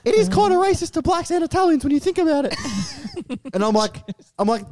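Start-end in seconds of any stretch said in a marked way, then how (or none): 0.1-2.6 lampoon the friend in a squeaky voice; mock friend. Imitating the podcaster conceding, in a high mock voice. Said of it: "It's like".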